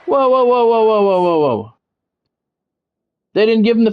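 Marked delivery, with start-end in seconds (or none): none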